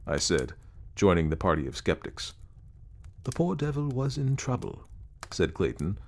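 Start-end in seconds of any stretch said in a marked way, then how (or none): 3.2-4.9 higher-pitched, gently reproachful; the very faintest note of reproof. A narrator's reading. Clayton defends the poor ghost to the skeptics, faintly reproving. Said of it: "with the very faintest note of reproof".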